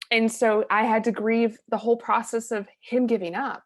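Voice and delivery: ordinary talk, nothing marked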